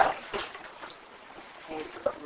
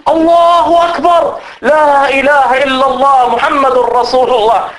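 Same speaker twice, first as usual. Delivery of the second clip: crowd shouting praise; 8 semitones higher than usual. Retold or imitated. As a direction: cry it out like an excited crowd. Crowd cries out at the fake Jesus.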